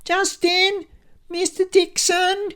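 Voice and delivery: high-pitched scream for help; piercing, panicky receptionist screech; Lucy. A narrator's reading. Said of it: "screaming in her typically high-pitched voice".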